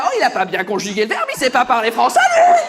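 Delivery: scoff, rising to a shrill-topped shout; mock outrage. French reader mocking another's email, mounting mock outrage.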